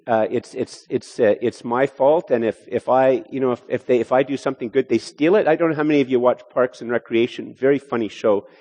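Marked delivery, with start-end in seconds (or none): none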